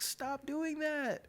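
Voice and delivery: very high, stretched-out mock scream; alarmed girlfriend. Girlfriend stopping him punching, imitated high frantic plea. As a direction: wail it slowly in her imitated voice.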